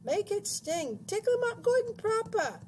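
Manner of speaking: very high, loud screeching hag; shrieking. A narrator's reading. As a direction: egg him on in a piercing screech. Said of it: "shrieked Missus Pratchett".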